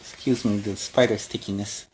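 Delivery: ordinary delivery, nothing marked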